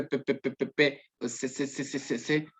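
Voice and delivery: soft repeated pe and se syllables. Shown as a demonstration. Teacher demos the distortion, quiet repeated syllables. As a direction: repeat pe then se quietly, like a child stuck. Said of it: "esa es la distorsión".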